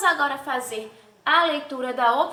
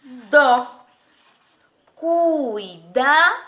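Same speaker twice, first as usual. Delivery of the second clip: "dó" punched alone, then "cuidado" slow in three beats; syllable separation; 4 dB louder, much slower than usual. Shown as a demonstration. Teacher completing the separation, "dó" and "cuidado" in separate beats. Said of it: "Como é que vai ficar a separação silábica".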